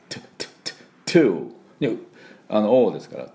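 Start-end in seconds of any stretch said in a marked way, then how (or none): 0.1-1.5 "to" as a short aspirated T burst, repeated; popped T drill. Shown as a demonstration. Teacher drilling the air burst, popped English T. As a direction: spit out the T of "to" in separate puffs, no ts. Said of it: "飛ばす音を出す".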